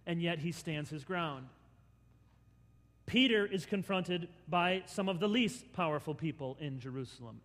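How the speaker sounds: ordinary delivery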